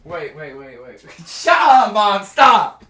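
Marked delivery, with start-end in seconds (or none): none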